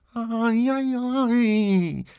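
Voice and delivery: put-on very high, loud child's yelp; drawn-out, piercing mock "ow"; shrill feigned pain. A narrator's reading. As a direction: squeal as if hurt, pretending. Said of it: "gridò la bambina con voce acuta".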